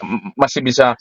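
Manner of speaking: normal talking voice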